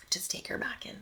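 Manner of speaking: doctor's advice dropped to a whisper. Retold or imitated. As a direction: murmur it under the breath. Quoting doctor's instructions.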